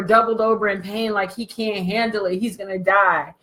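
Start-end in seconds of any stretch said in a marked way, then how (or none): none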